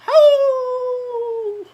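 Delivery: very high, loud hoot; howl. A narrator's reading. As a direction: howl at the moon. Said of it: "howled at the moon".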